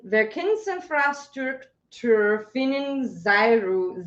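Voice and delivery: slow, careful syllable by syllable Werkensinfrastruktur; struggling through a long German word; sounding out German. Real, not acted. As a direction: pick through Werkensinfrastruktur slowly. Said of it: "this ridiculously long German word".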